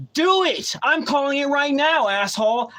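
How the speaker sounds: high, heated re-enacted challenge; defiant retort. Defiant retort to trucker, retold.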